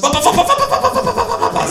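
high, rapid-fire chanting of one word; machine-gun kangaroo babble; insistent kangaroo. A narrator's reading. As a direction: cut in and repeat it relentlessly, very high. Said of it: "sagt das Känguru".

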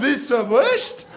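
slow, spelled-out mock mother repeat; mother repeating slowly. Mother repeats the sausage offer to her son, slow and exaggerated. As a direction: drag it out as if he's slow. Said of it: "hat die Mutter runtergesprungen und hat gesagt".

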